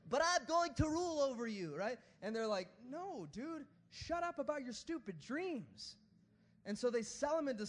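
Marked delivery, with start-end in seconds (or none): 0.1-1.7 high, loud nerdy boast; nerdy Joseph voice. Acted. Preacher as Joseph to his brothers, nerdy insistence. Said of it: "in that voice when I talk about Joseph". 2.9-6.0 high, drawn-out dismissal; mock exasperated. Joseph's brothers shutting down his dreams, exasperated.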